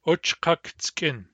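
Uchkaktskin said slowly, syllable by syllable; slow demo. Shown as a demonstration. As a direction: stretch Uchkaktskin out for learners. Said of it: "starting from the stem".

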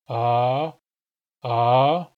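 a loud, open ah that flexes the tongue root. Shown as a demonstration. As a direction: hold a loud, open ah and feel the throat. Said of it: "feel your tongue root flexing".

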